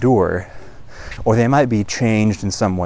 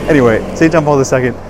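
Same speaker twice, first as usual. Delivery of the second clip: laughing; 4 semitones higher, 6 dB louder, faster than usual. Real, not acted. Recovering from laughing at own joke.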